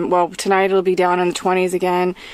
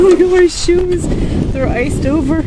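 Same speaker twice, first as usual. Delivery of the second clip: high laughing disbelief; 5 semitones higher than usual. Real, not acted. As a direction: laugh through the words. Laughing at iced shoes.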